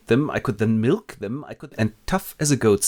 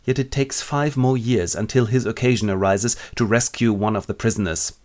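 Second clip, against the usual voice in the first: normal talking voice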